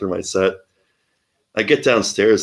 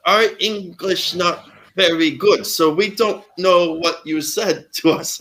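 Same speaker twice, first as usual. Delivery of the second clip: high, slow foreign learner's English; broken English; 8 semitones higher, slower than usual. Retold or imitated. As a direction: imitate a hesitant non-native student. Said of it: "they had really broken English".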